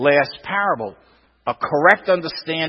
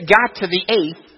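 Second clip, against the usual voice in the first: normal talking voice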